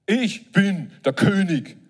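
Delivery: lion's proud claim. Acted. Lion proclaiming its rank.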